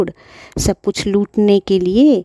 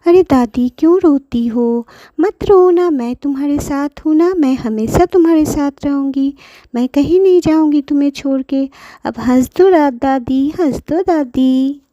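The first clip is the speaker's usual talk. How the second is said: put-on higher, coaxing little girl; child comforting; 6 semitones higher than usual. Pinky comforts crying grandmother, higher coaxing child voice. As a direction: soothe granny like a small child. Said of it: "Pinky बोली".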